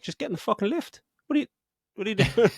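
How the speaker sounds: high, incredulous yelling at the screen; urging him into the lift in disbelief, pitched high; exasperated. Retold or imitated. Viewer yelling at Kevin Hart to get in the lift, high exasperation. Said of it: "It's just like".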